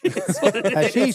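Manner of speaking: high-pitched giggly words through a laugh. Real, not acted. Laughing about hash.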